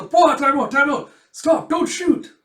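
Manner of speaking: high, loud panicked plea; panicked shout. Pretend. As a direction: yell at him not to shoot. Soldier fired a warning shot.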